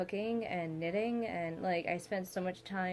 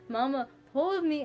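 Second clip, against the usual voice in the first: put-on toddler plea, louder and higher; 7 semitones higher, 6 dB louder than usual. Daughter calling for her mother, a louder toddler plea. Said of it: "she'll get louder and louder".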